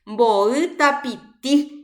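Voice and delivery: MBO'Y TAPITI high and very slow, final syllables stretched; stressed syllable demonstration. Shown as a demonstration. Teacher asks students where TAPITI is stressed, stressed demonstration. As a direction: lengthen and lift the last TI of TAPITI. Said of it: "Dónde pronuncio".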